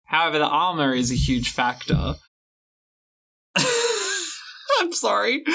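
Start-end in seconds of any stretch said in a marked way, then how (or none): 3.6-5.4 real giggles breaking into the words; uncontrolled laughing. Real, not acted. Apologizing for not being able to stop, uncontrolled laughing. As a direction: apologize through high laughter. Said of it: "I can't stop laughing now".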